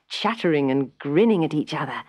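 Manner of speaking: usual speaking voice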